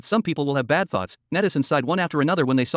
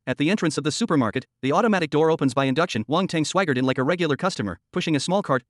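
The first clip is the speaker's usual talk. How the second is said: plain reading voice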